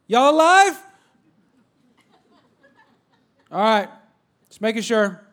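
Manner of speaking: loud, high call across the house; calling out to the kids. Retold or imitated. Dad calling out to his kids on a checkup, loud. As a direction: shout up to check the kids are alive.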